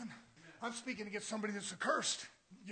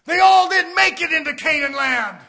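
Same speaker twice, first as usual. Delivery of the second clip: loud, high fire-and-brimstone preaching; preaching shout; 8 semitones higher, 23 dB louder than usual. Acted. Preacher shouting to the church about Canaan. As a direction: thunder it at the congregation.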